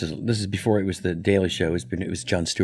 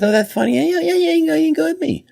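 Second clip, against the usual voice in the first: very high, loud mock Leno; imitating Jay Leno eagerly; Jay Leno impression; 5 dB louder, faster than usual. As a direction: chirp it out like Jay. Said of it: "And he goes".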